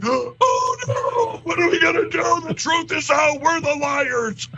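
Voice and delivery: high, whiny mock panic; mock panicked Murdochs. Mocking the Murdochs as they panic over the truth, high whiny squeal. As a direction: squeal it like a panicking media mogul. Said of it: "you'd have the Murdochs going".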